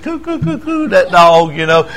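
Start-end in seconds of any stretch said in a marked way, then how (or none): 0.0-0.9 high mock baby-talk cooing. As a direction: coo at the dog like a doting owner. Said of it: "it just goes on and on".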